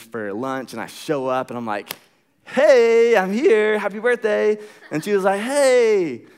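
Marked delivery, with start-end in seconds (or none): 2.4-4.6 high, loud surprise arrival; excited greeting. Retold or imitated. Man surprising her on her birthday, excitedly. 5.4-6.3 high, loud imitated "hey"; mock excited greeting. Birthday woman greeting her surprise visitor, mock-excited.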